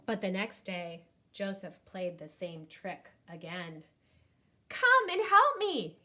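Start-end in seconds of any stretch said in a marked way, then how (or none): 4.7-6.0 high, loud call for help; boy calling the village men, loud fake alarm. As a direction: shout it up the hill. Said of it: "he called".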